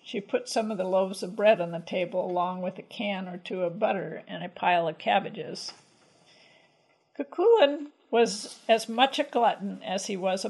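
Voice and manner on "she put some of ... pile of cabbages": usual speaking voice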